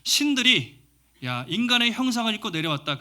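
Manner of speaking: high, loud astonished cry; 흥분한 사투리 외침; excited dialect shout. Retold or imitated. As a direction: shout it like villagers seeing a miracle. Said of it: "자기 사투리가, 자기들의 이제 말로".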